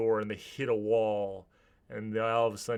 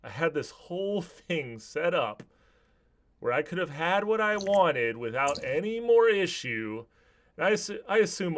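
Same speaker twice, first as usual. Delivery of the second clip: higher, worked-up villain's complaint; exasperated villain; 7 semitones higher, 4 dB louder than usual. Acted. Bertram berates the man who came back, exasperated. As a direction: build in frustration and volume. Villain rants at his victim.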